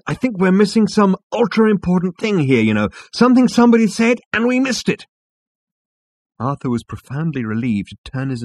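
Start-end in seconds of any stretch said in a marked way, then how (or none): 0.1-5.1 urgent, high-pitched, loud; excited and insistent, well above narration; Zaphod. A narrator's reading. Zaphod warning friends they missed something, high excited voice.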